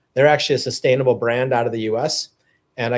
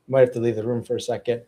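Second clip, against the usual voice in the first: usual speaking voice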